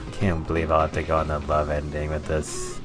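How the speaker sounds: ordinary delivery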